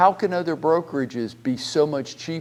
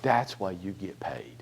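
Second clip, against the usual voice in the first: whisper; 5 semitones lower, 7 dB quieter than usual. Real, not acted. Coach drives home his point.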